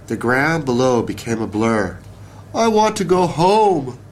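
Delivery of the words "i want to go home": high, mock-wailing little bug; a storm-tossed bug wailing for home. A narrator's reading. Termite tossed on the branch in the storm, wailing high. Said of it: "the bug wailed".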